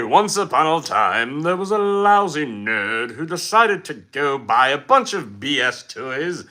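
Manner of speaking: slow, drawn-out fairy-tale narration; storytelling. Pretend. Streamer answering a viewer's request for a story, slow storytelling voice. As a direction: tell it like a mock bedtime tale. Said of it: "Can you tell a story?".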